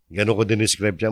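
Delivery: usual speaking voice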